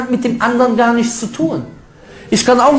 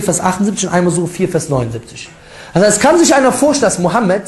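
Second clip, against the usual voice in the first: ordinary delivery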